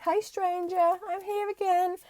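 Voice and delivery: high, playful greeting voice, slowing at the end; past calling out. Acted. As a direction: greet them like an old friend back again. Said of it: "You may find the past is going".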